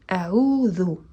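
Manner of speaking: "A'udhu" with the th-like dhal, tongue slightly out; correct dhal. Shown as a demonstration. Teacher showing the correct "A'udhu" to students. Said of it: "Esta es la manera correcta de decirlo".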